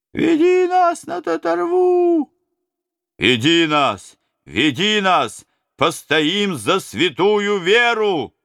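high, slow, loud battle cry; rallying shout. Acted. Robbers to the prince, slow high battle cry. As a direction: shout it as a vow.

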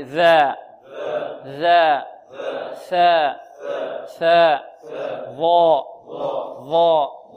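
"ذا، ثاء، ظاء" one by one, long vowels, tongue tip at upper teeth; teacher modelling "ذا، ثاء، ظاء", each sound drawn out; letter articulation drill. Shown as a demonstration. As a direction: say "ذا، ثاء، ظاء" isolated and firm for repeating. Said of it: "انطقوا معي".

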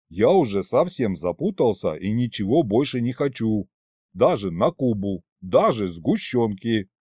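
ordinary delivery, nothing marked

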